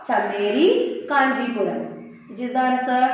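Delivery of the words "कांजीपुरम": normal talking voice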